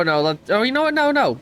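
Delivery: ordinary talk, nothing marked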